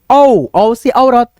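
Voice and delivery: औ stretched long and slow, then औ से औरत; drawn-out vowel demo. Shown as a demonstration. Teacher stretching औ slowly for the class. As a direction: sound औ out very slowly. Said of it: "इसको क्या पढ़ेंगे".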